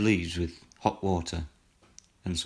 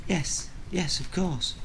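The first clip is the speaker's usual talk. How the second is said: put-on high, eager child's voice; little girl; 10 semitones higher, slower than usual. A narrator's reading. Girl insisting she behaved at school, little girl voice. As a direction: pitch it right up as a young daughter.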